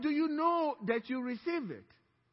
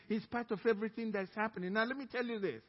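ordinary talk, nothing marked

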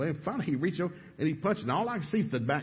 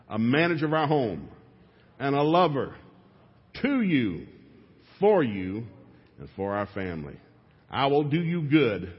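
preacher leading vows. Shown as a demonstration. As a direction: lay out each vow for the men to echo. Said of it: "Ladies and ladies only".

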